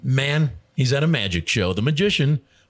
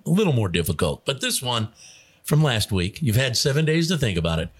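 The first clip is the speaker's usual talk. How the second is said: ordinary delivery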